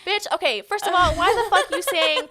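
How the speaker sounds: loud, high, laughing indignation; play-offended, cracking up; mock outrage. Calling out her friend for mocking astrology, laughing outrage.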